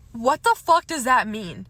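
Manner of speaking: loud, exasperated disbelief; outraged. Real, not acted. Reacting to the date's text about patience, outraged. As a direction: demand an explanation, loud and indignant.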